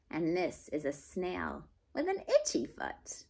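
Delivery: slow, gentle read-aloud; story reading to children. Acted. Teacher reads a picture book aloud to the class, slow and gentle. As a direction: read it slowly to little listeners.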